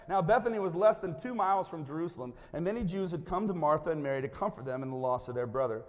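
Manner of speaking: ordinary talk, nothing marked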